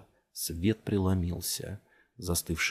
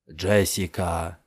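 calling out; 2 semitones lower, 3 dB louder, much slower than usual. A narrator's reading. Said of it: "позвал её".

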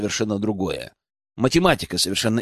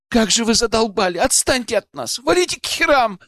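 high, strained outburst without much volume; tearful yelling; 10 semitones higher than usual. A narrator's reading. Алена breaks down at the men outside, crying out. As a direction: cry it out, pitched way up. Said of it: "кричала она, вырываясь из моих рук".

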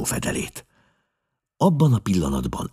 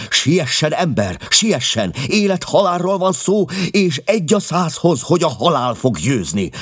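high, loud self-reproach; agitated outburst, pitched well up; frantic urgency; 9 semitones higher, 4 dB louder than usual. A narrator's reading. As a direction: cry out in alarm, racing against time. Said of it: "kezdte nyugtalanul".

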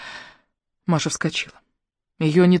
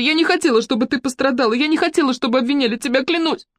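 much higher, pleading female voice; distraught swearing, pitched right up; desperate insistence; 8 semitones higher than usual. A narrator's reading. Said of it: "твердила Мотя".

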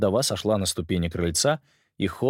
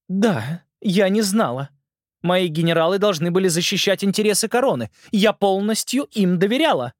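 lightly voiced uncertain queen; a queen admitting fault, unsure; hesitant queen voice; 10 semitones higher, 4 dB louder than usual. Ant Queen speaks.

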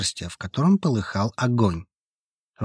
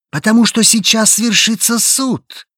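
higher, louder roaring verdict; 11 semitones higher, 5 dB louder than usual. Acted. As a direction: bellow the judgment out. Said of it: "— взревел голос". (ревущий голос)

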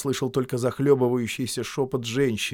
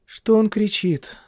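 woman's voice; 11 semitones higher, 6 dB louder, slower than usual. A narrator's reading. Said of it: "спросила женщина".

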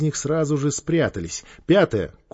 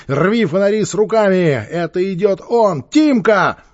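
loud, high rallying yell; shouting; 7 semitones higher, 6 dB louder than usual. A narrator's reading. Gang leader to his ambushed boys, shouting. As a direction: yell orders over the fight. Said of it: "крикнул Квакин".

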